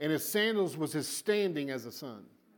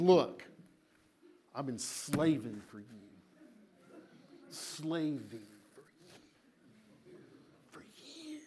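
quieter, drawn-out grumble fading into a whisper on "For years"; resentful older son; 6 dB quieter, slower than usual. Shown as a demonstration. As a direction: mutter it like a bitter son who feels unpaid. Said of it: "That's how he said it".

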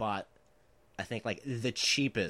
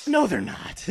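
disbelieving; 13 semitones higher, 12 dB louder, slower than usual. Retold or imitated. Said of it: "He goes".

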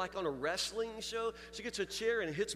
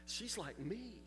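hushed realization; 4 semitones lower, 12 dB quieter than usual. Real, not acted. Quiet moment of realization.

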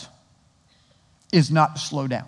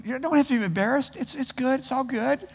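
playful teasing; 8 semitones higher, 4 dB quieter than usual. Pretend. Teasing embarrassed Andy Griffith fans.

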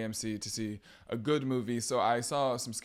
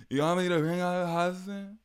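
slurred mock British accent, pitched up; drunk British accent; 7 semitones higher, 4 dB louder than usual. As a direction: imitate a tipsy Real Housewife going posh British. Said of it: "Slurring her shit and talking in a British accent".

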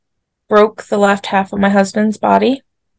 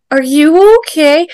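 higher, louder concerned question; concerned old lady; 6 semitones higher, 5 dB louder than usual. Retold or imitated. Old lady behind them asks the waiting woman, concerned. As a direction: imitate a little old lady checking on a stranger. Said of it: "She was, like, really concerned".

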